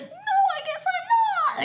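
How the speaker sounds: high, loud, drawn-out pretend wail; mock crying child. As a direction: imitate a kid bursting into tears. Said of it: "and cry".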